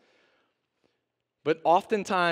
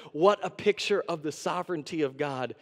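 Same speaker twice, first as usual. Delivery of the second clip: ordinary talk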